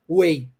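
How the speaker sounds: "way" said exactly like the word way, with no G H sound; teacher modelling "way" for the spelling W E I G H; model pronunciation of weigh. Shown as a demonstration. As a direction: say "way" and keep the G H silent. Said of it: "não se pronuncia o G H".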